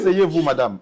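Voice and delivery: loud call. Real, not acted. Mediator asking the wife to sit, loud. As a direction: call her back to her seat. Mediator calls over the quarrel.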